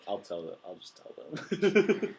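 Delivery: teasing threat. Pretend. Host teasing that he'll reveal their last names, slow and sly. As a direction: drag it out mischievously.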